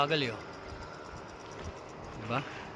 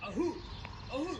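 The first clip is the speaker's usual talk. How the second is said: out of breath, panting; winded from the climb; 9 semitones higher, 13 dB quieter, faster than usual. Real, not acted. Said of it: "Medyo hiningal ako".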